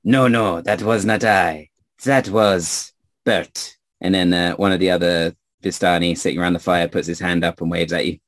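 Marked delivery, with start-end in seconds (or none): 0.0-6.2 put-on French-accented denial, slowing at the end; French-accented storyteller correcting a listener. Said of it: "stranger with a French accent".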